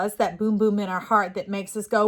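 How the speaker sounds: ordinary delivery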